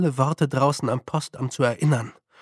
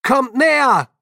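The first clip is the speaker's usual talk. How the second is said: high, loud hail; raised, carrying shout; calling out; 9 semitones higher, 7 dB louder than usual. A narrator's reading. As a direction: call them over from across the hall. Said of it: "rief Feucht".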